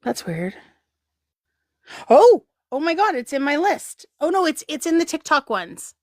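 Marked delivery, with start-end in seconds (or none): none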